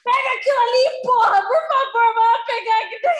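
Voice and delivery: loud shouting in Portuguese; screaming family. Retold or imitated. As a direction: yell it like an Italian-Brazilian family argument. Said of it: "talk to each other, screaming".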